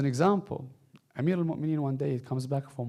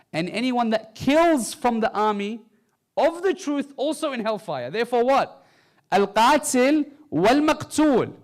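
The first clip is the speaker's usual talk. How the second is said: much higher, louder impassioned preaching; climactic preaching; 10 semitones higher, 9 dB louder than usual. Real, not acted. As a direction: build to a fiery peak. Preaching climax at full force.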